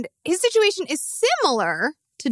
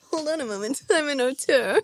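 mock actor; 3 semitones higher than usual. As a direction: lightly imitate a precious auteur actor. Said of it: "I love when [name] acts".

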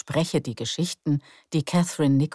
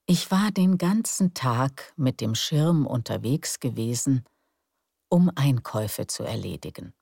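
calm, unhurried storyteller; bedtime story narration. A narrator's reading. (ruhige Gutenachtgeschichte)

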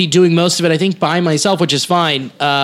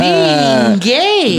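high mock indignation; 11 semitones higher than usual. Retorting to a man's odd answer.

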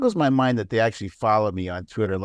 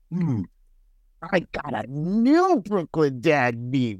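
scheming troll; 2 semitones higher, slower than usual. Acted. Imagines troll waking with a plan.

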